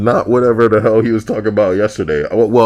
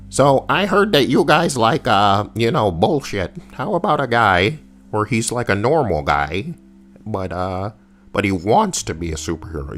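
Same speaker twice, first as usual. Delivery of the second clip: lightly voiced pitchman with a raised opening; mock game developer; 4 semitones higher than usual. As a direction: imitate someone floating a bad idea. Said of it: "when it seems like somebody's like".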